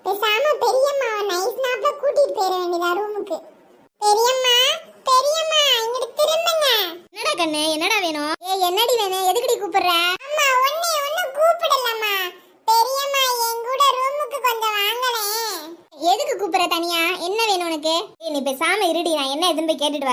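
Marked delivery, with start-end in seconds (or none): 4.0-6.7 higher pleading call. Acted. Child calling aunt again to turn around, higher and pleading. 10.3-15.6 put-on high child voice wheedling; coaxing child. Child coaxing aunt into the room, high and wheedling.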